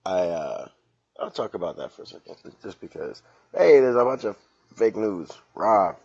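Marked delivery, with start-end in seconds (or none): 3.6-5.9 mock shout.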